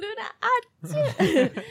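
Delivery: slow laughing sneeze; sneezing. Pretend. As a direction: sneeze the name while giggling. Sneezing her name, laughing.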